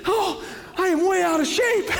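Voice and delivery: high, breathless imitation of a runner; breathing while running. Runner filming where Jesus ascended, comically out of breath. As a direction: act winded, voice pushed way up, laughing at yourself. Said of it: "I'm breathing, trying to".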